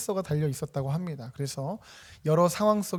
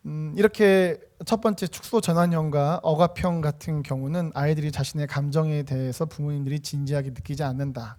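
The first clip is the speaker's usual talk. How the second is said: usual speaking voice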